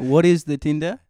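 put-on a touch of a French accent, baffled; confused French guard. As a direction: play the guard lost over a strange word.

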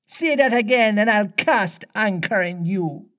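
high-pitched pirate threat; theatrical seafaring warning, high; retort. A narrator's reading. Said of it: "the voice retorted".

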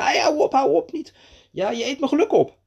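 high, urgent storybook cry; alarmed protest. A narrator's reading. Mees scolding the grasshopper, alarmed protest. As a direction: cry out to stop the nibbling.

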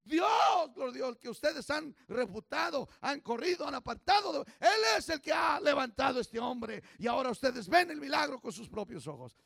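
loud, fervent preaching; fiery preaching. Acted. Pastor preaching loudly to the congregation, fiery. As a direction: preach it out to the crowd.

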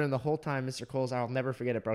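normal talking voice

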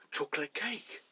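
mock-astonished discovery; hammed-up gasp of delight; gasping realisation. Acts out sudden remembering.

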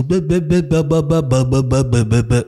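rapid stuttering "b-b-b" machine sound; robot machine noise. Retold or imitated. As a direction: imitate a rattling machine, descending in pitch. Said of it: "so Roboter und Maschinen".

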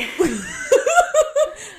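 loud high burst of laughter. Real, not acted. Laugh after confession.

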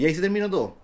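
usual speaking voice

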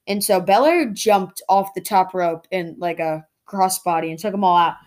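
normal talking voice

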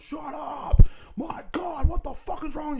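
very high, loud exasperated screech; top-of-lungs screaming. Retold or imitated. Man yelling at barking dogs, top-of-lungs high scream. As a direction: scream yourself ragged at a dog. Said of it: "screaming at the top of my lungs".

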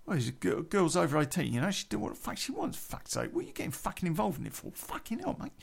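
high, fast mock Cockney rant; imitated protesting Cockney housemate. Housemate defending a girl over eighteen, high fast mock protest. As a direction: mimic him squawking indignantly.

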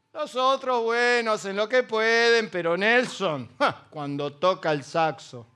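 slow, hammed-up admiration with scoffing laughs; mock praise of Nelson. Preacher imitating people belittling others beside Nelson, slow mock awe. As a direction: gush over Nelson mockingly. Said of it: "¿Nunca te ningunearon?".

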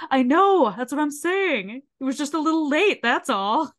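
high, insistent mock pleading; eager pleading. Ricky pleading with the teacher over the late chicken, eagerly insistent.

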